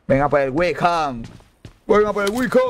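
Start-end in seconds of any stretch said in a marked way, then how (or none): 1.9-2.6 high, urgent summons to come; high-pitched call. Real, not acted.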